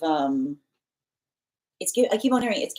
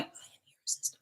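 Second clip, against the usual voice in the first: hushed whisper of a boast; whispered self-assurance; 17 dB quieter, much faster than usual. Retold or imitated. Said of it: "they're whispering it like that".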